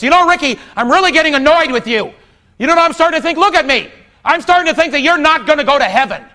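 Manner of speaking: loud, high angry rant; Mike losing it. Retold or imitated. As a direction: tear into your brother, shouting. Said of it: "he lost it".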